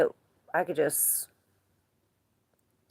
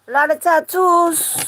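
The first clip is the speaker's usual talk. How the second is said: high silly voice; 11 semitones higher, 8 dB louder than usual. Pretend. Repeating a viewer's tattoo line.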